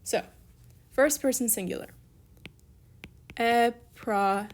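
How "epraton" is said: slow, drawn-out "Epraton" in separate pieces; syllable by syllable. Shown as a demonstration. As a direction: spell it out slowly by sound. Writing out the form slowly.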